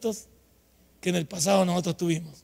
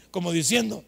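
ordinary talk, nothing marked

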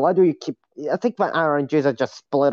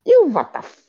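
shocked squeal; 4 dB louder than usual. Real, not acted. Shocked at hating grapes.

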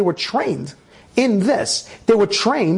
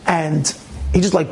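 ordinary talk, nothing marked

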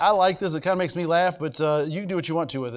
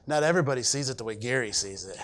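lower, softer imitation of her saying; Renee; 6 semitones lower, 5 dB quieter, faster than usual. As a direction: quote her in a low, understated voice. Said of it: "She says it just like that".

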